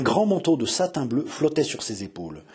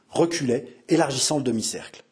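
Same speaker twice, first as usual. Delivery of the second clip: plain reading voice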